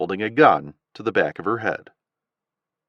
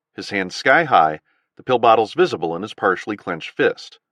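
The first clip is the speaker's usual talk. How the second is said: ordinary delivery, nothing marked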